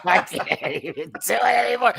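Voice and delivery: giggling through the words; laughing. Real, not acted. To the co-host after a joke, laughing through it.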